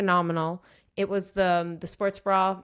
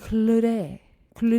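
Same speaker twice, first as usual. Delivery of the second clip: exaggerated French pronunciation; French accent; 3 semitones higher, 5 dB louder than usual. Shown as a demonstration. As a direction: say it like a French speaker, drawn out. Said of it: "now say it with a French accent".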